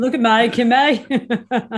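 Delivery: exaggerated Australian "Kimmay"; imitated Aussie accent. Shown as a demonstration. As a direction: overdo the broad Aussie vowels. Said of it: "in your best Aussie accent".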